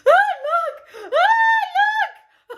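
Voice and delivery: very high, loud wail breaking into laughter; mock crying. Woman showing her friend the email, hammed-up tearful wail. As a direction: imitate sobbing while showing someone good news. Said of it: "Did I not cry in front of my colleagues".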